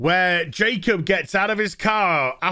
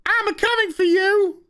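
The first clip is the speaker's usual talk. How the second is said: high, hammed-up squeaky villain rant; high mock villain voice, overacted; 11 semitones higher than usual. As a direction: ham up a ridiculous villain's threat. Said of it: "takes the microphone and says".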